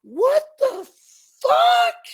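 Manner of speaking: a piercing falsetto shriek of shock; scream. Retold or imitated. Sally walks in on the naked men and screams high and loud. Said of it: "and she screams".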